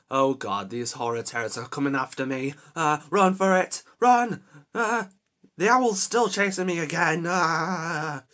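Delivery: loud high frantic yells; panicked shouting. Real, not acted. Gamer chased by the owl again, shouting in panic. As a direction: flee screaming for your life.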